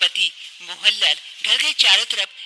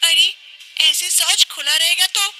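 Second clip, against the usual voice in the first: put-on high-pitched young wife, breathless and relieved; young wife explaining; 9 semitones higher than usual. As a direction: tell her mother-in-law how you escaped. (ऊँची आवाज़ में बहू, राहत भरी)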